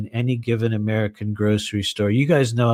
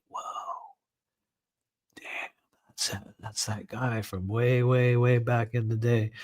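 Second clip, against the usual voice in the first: stunned whisper; 7 dB quieter than usual. Retold or imitated. Narrator remembering spotting an old acquaintance, hushed disbelief. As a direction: breathe it out like you can't believe who you see. Stunned recognition, under breath.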